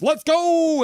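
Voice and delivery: high, loud let's go; fan cheer. Retold or imitated. Voicing a chatter's cheer, high and loud. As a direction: shout it like a fan in the stands. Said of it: "And then Caleb Jones says".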